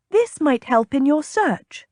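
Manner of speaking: triumphant. A narrator's reading. Lady producing a bottle for the captain, triumphant. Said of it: "She held it up triumphantly".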